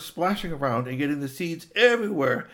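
ordinary delivery, nothing marked

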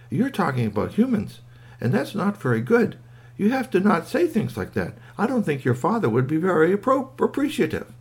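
high-pitched, prim little reproach; scolding squeaky toy. A narrator's reading. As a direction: gently tell the child off in a toy voice. Squeaky gently scolds Kwame.

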